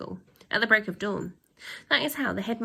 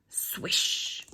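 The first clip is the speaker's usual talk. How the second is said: whooshing swish. A narrator's reading. Sound of cape twirl.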